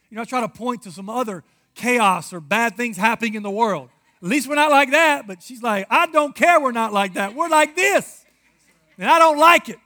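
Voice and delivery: loud, impassioned pulpit preaching; loud sermon, pouring his heart out; full-force preaching. Acted. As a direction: preach it out to the whole church.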